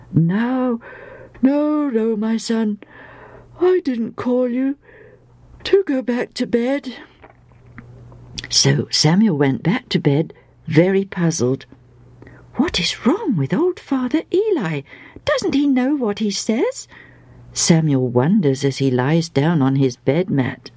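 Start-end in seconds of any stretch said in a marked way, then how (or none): 0.1-6.9 a lightly voiced old priest, higher and gentle; a hint of an old man soothing a boy; old priest Eli, kindly. Eli gently sending young Samuel back to bed, lightly voiced. 12.6-16.9 put-on higher, whispered wondering of a young boy; puzzled boy whispering. Young Samuel wondering to himself about Eli, whispered.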